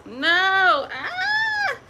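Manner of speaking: high, loud sobbing cry; real crying outburst. Real, not acted. To viewers about Mister Big, crying out loud. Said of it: "I'm actually crying".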